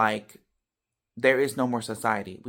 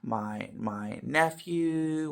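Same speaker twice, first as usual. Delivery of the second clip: drawn-out, higher imitation of grieving relatives; mock wailing; 6 semitones higher, much slower than usual. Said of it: "yelling and screaming and crying".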